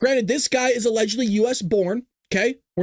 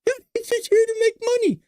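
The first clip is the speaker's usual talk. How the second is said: much higher, gushing mock voice, rising to a shout; mocking naive liberal; 11 semitones higher than usual.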